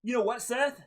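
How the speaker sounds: high, loud, laughing mock outburst; mock shouting Ray. Sister acting out Ray disowning his daughter, loud mock shout. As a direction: yell it like a wrestler cutting a promo, half laughing. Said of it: "Is if Ray would have said".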